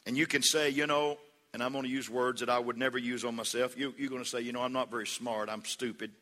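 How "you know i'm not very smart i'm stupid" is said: low, quiet, downcast self-put-down; acted defeatist murmur, pitched down; negative self-talk. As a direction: voice someone running themselves down, low and subdued. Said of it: "you can have a positive attitude".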